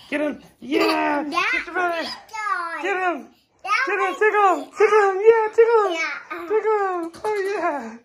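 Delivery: high, loud, laughing cheers. Real, not acted. Mother urging baby to tickle brother.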